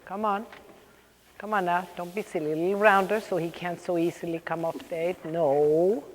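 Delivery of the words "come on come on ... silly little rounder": usual speaking voice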